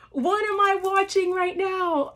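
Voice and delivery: high, awestruck question; amazed disbelief. Retold or imitated. Fan reliving the main event, amazed. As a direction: marvel at what you're seeing. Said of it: "I'm just like".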